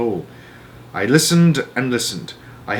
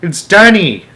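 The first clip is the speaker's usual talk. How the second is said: high, loud desperate call; boy shouting for his lost father; 8 semitones higher, 7 dB louder, slower than usual. A narrator's reading. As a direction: yell into the darkness. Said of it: "I shouted".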